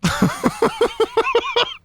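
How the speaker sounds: high stuttering laugh; dying of laughter; helpless laughter. Real, not acted. Player dying at the randomizer, helpless laughter.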